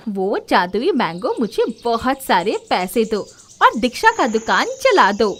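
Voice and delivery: loud, grasping command; greedy demand. Acted. Komal greedily demanding money from the mangoes, loud command. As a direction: order the magic mangoes greedily.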